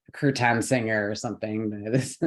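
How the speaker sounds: normal talking voice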